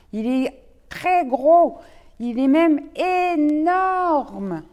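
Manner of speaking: slow emphatic. Acted. Rabbit exaggerates slowly.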